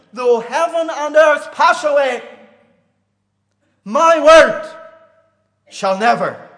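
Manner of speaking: loud, high, ringing pulpit cry; thundering preacher hammering each line; full-force preaching. Acted. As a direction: proclaim it at the top of your voice.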